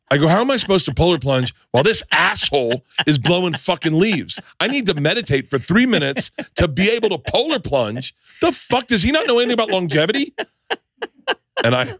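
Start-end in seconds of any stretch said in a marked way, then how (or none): 0.3-10.3 indignant mock rant. Comic replaying his tirade about the noisy neighbour, indignant rising rant. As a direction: pitch climbing in exasperated disbelief.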